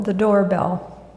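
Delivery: ordinary delivery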